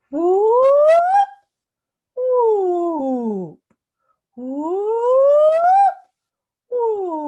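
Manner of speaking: high voiced whoop, gliding up then sliding down; loud siren-like "whoo" sweeping through the pitch. Shown as a demonstration. Speech coach leading a voice box warm-up, whooping. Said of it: "Let's whoop".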